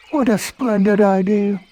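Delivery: high cheerful cartoon bear; Pooh voice. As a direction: play Pooh, delighted and hopeful.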